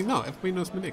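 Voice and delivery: normal talking voice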